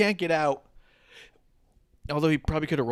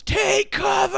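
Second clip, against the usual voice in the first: much higher, louder hammed-up shout; mock yell; 6 dB louder, slower than usual. Host imitating Excalibur's call to his co-host, loud mock shout. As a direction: bellow it like a panicked commentator. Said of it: "fucking Excalibur goes".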